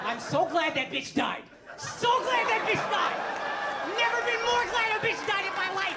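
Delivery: high, loud gloating over a death; gleeful comic rant at the crowd. Acted. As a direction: crow it out, delighted and savage. Onstage rant about the queen.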